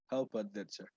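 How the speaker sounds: soft, barely audible question. Real, not acted. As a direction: ask it almost too quietly to hear. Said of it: "Your voice is very low".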